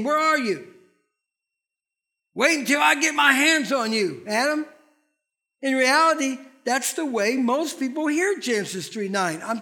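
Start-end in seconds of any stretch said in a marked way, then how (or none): 0.0-4.7 angry cosmic cop. Retold or imitated. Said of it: "a cosmic cop saying".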